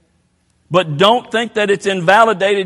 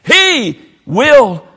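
slow, louder closing over music; closing appeal; 3 semitones higher, 5 dB louder, much slower than usual. Real, not acted. Preacher ends his altar call over the music, slow. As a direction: ease into the invitation, slow.

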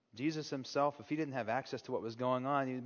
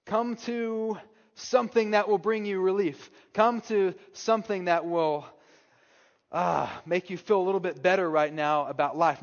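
mock offer; 4 semitones higher, 7 dB louder than usual. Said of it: "he doesn't say".

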